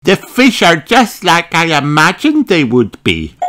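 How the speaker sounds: high, lively piglet voice; Peppa. A narrator's reading. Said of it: "replied Peppa".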